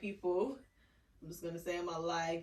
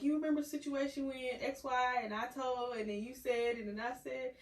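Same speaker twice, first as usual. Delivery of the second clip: higher-pitched, lightly acted recounting; tactful reminder; 6 semitones higher than usual. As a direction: lift the voice as if gently walking someone through it. Acts out raising past incident.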